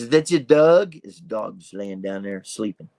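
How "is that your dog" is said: lightly voiced bumbling inspector; a hint of movie-detective impression, raised and louder; Inspector Clouseau. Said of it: "Inspector Clouseau here".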